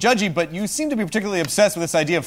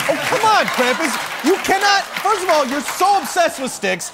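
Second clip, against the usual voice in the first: exasperated; 6 semitones higher than usual. Acted. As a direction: push back in disbelief. Arguing with Krampus.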